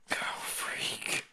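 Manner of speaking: breathless whisper; whispered curse. Real, not acted. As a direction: hiss it under your breath. Bad roll reaction.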